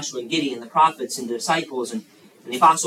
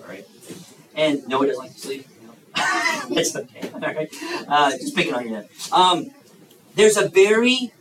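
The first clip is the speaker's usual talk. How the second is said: usual speaking voice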